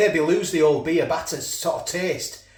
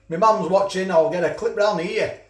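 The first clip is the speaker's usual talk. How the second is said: ordinary delivery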